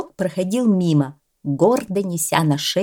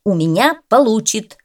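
firmly, a bit louder and higher, no doubt allowed; a firm cat's retort; firm declaration; 4 semitones higher, 6 dB louder than usual. A narrator's reading. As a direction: state it flat out as settled. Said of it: "твёрдо заявил Белый".

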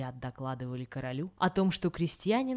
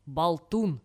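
plain reading voice

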